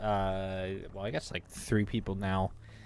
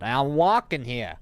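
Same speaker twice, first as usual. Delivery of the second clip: loud, slow Boston-style yell, higher; 5 semitones higher, 8 dB louder than usual. Retold or imitated. Yelling at forklift drivers crossing in front, loud Boston-style shout. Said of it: "And I just yelled out loud".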